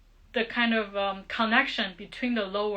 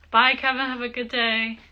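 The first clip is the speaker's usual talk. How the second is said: calling out goodbye; 3 semitones higher, 5 dB louder than usual. Real, not acted. Teacher breaking off to see Kevin off, calling out. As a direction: call a friendly goodbye across to someone. Calls goodbye to someone leaving.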